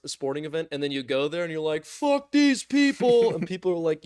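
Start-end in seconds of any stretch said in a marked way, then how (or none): 1.9-3.3 high, slow, loud sneer; hammed-up jeer at the home crowd; mock sneer. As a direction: drag it out like a clueless fan. Fan insulting own team.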